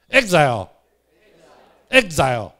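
exile said slowly, loudly and clearly for the class to repeat; teaching the word exile. Shown as a demonstration. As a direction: call out exile as a model for the class. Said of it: "大家做伙读一摆". (大声慢慢示范 exile 的读音)

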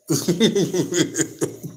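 real giggling laugh; amused laugh. Real, not acted. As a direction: chuckle at your own joke. Amused at his Canelo bit.

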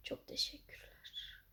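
breathy whisper. Real, not acted. Gamer whispering thanks to his viewers. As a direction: whisper it under your breath.

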